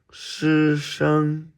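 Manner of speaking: "师生" held high and level; first tone. Shown as a demonstration. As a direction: sustain both syllables of "师生" on one high note. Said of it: "All first tones".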